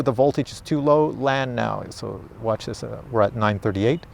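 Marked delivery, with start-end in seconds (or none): none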